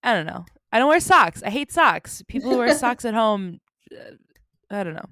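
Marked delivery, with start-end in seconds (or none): 0.7-3.6 mock rant. Real, not acted. Ranting about hating socks.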